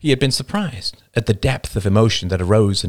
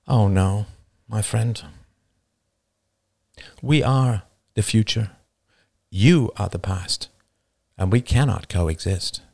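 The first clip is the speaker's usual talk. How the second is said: almost gentle. Acted. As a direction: settle the voice into calm gentleness. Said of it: "David's voice grew almost gentle".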